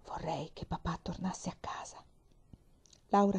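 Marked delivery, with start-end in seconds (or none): 0.1-2.0 soft unvoiced whisper from under the covers. Mary whispering from under the blanket, wishing for father. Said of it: "e disse sottovoce".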